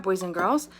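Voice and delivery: ordinary talk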